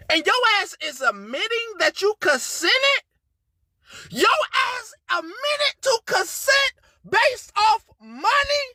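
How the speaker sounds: high, tearful and breaking up; fighting back tears as she speaks; crying. Real, not acted. To the woman who lied, crying in high-pitched disbelief. Streamer breaking down over consent claim.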